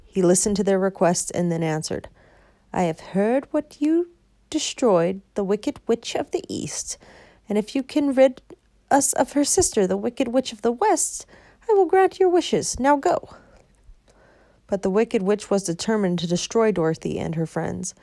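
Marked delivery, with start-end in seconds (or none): 2.7-13.3 higher booming wizard decree; grand commanding wizard; lightly voiced wizard giving orders.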